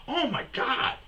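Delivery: high-pitched, disbelieving cry; exasperated alarm. Retold or imitated. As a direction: exclaim it as if watching a near crash. Alarm at near hit on car.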